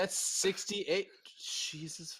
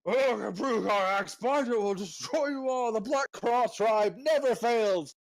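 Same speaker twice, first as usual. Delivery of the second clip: booming, high and loud war boast; orc leader voice; 7 semitones higher, 5 dB louder than usual. Acted. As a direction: roar it like a proud orc chieftain.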